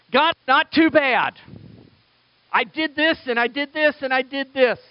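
pat yourself on the back before God; smug self-praise. Retold or imitated. Believers listing deeds to God, high smug boast.

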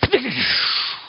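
mouthed blast with a long hissing fade; imitated zapping noise. Preacher joking how he'd zap the arrogant man, hissing blast noise. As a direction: make a sizzling whoosh of incineration. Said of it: "I probably would have gone".

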